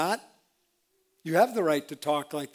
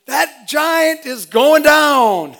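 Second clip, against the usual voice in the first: slow, loud battle shout, much higher; war cry shout; 9 semitones higher, 11 dB louder, much slower than usual. Retold or imitated. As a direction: bellow it like a battle cry at the giant. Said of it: "He could have just as well shouted".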